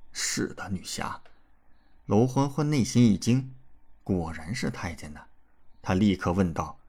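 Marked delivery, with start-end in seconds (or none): 0.1-1.2 whispered, scared stiff; frightened whisper. A narrator's reading. Eunuch answering the swordswoman in a frightened whisper. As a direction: barely breathe the answer out.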